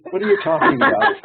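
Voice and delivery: wife's incredulous question broken by laughter; laughing wife impression. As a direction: squeak it out through a laugh as the baffled wife. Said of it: "she'd say".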